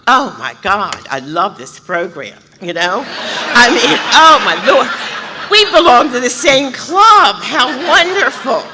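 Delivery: high, loud, laughing gush; mock-swooning over her crush at the meeting; giddy excitement.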